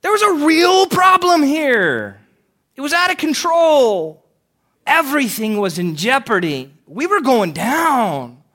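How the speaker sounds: high, loud mock-exasperated Peter; snarky Simon Peter. Peter shouting back at Jesus about the storm, high and exasperated. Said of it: "I just picture somebody like Simon Peter going".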